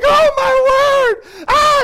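high, slow, loud gasp of disbelief; hammed-up amazed cry. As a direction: play the stunned man watching money fly. Guy sees money flying.

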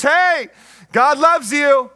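loud, high, punchy proclamation; mock preaching. Mimics shallow preaching.

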